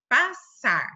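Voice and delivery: PASSAR split slowly into pas and sar; slow, divided "passar"; syllable by syllable. Shown as a demonstration. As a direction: say "passar" in two separate beats. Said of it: "é obrigatório separá-las".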